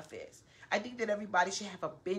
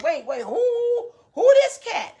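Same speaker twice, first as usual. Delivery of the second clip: mother's voice; 13 semitones higher, 13 dB louder, slower than usual. Retold or imitated. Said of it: "My mother's like".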